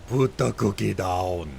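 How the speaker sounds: put-on slow Austrian-accented action hero line; Arnold Schwarzenegger. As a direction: do a drawn-out mock Austrian accent. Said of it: "doing an Arnold Schwarzenegger accent".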